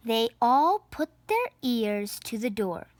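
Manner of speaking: ordinary delivery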